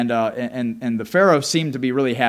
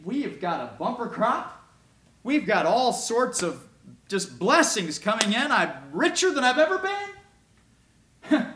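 higher and slower, gushing over his riches; delighted boasting; 7 semitones higher than usual. Acted. Potiphar boasting to Joseph about his riches, mildly excited. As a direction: savour every blessing. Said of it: "And Potiphar said".

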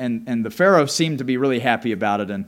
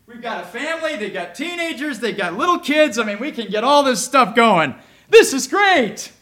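higher and louder, rising to a thrilled peak; excited thanks; 8 semitones higher, 6 dB louder than usual. Retold or imitated. Pastor thanking God for a new family, building to excitement. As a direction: get more and more thrilled. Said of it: "And I said".

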